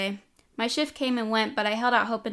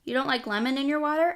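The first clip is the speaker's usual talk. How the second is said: higher-pitched, lightly teasing question; 6 semitones higher, 3 dB louder than usual. A narrator's reading. Teasing Taylor about her drink order, playful. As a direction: ask it with a teasing smile. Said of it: "a teasing smile working its way".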